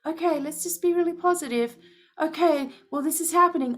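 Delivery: high, mock-cheerful sing-song; mocking her old self. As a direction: parody a forced-positive card reader. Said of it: "I can't sit here anymore".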